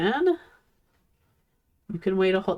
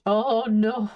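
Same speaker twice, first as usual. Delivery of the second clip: normal talking voice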